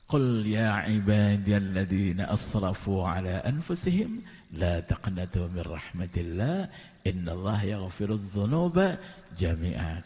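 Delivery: slow chanted Arabic recitation; Quran recitation. Acted. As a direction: intone the verse slowly, like tilawah.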